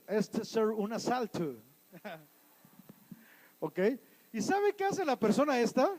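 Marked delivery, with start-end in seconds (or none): 0.1-1.6 slow, mock American-accented Spanish; gringo accent. Gringo robber announcing a hold-up in a store, mock American accent. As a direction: put on a clumsy gringo accent. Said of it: "es gringo, eh, a lo mejor no saben hablar inglés".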